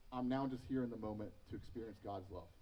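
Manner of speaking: gentle, at peace; calm. Retold or imitated. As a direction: settle into quiet acceptance. Calm acceptance ideal.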